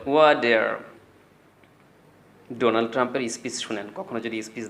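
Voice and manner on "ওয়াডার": "ওয়াটার" with t turned to d; slow American "ওয়াটার", r sounded; American accent. Shown as a demonstration. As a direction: say "ওয়াটার" as wa-der. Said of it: "এই পানিকে ওরা বলে".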